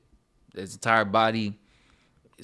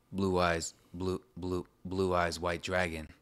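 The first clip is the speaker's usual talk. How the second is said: quiet mantra; 4 semitones lower, 8 dB quieter than usual. Shown as a demonstration. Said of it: "helps out so much".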